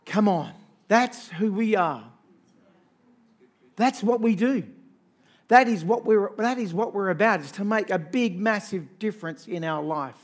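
loud, rising sermon call; rally the congregation, voice ringing; fervent preacher building up the crowd; preaching at full force. Acted. Sermon exhortation.

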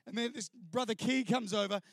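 ordinary talk, nothing marked

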